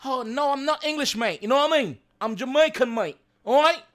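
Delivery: mock English accent, mate. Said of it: "say me sound like English".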